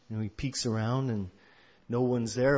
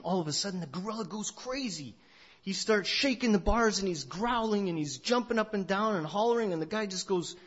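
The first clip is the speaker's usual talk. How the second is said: higher-pitched, sudden excitement in the telling; storyteller's rising alarm, pitched up without shouting; agitated storytelling; 6 semitones higher than usual. A narrator's reading. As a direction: tell it as the chaos breaks loose. Storyteller acts gorilla's sudden outburst.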